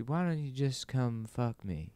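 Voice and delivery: put-on soft, languid old-man come-on. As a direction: drawl it slowly and very softly. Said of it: "You know, like this".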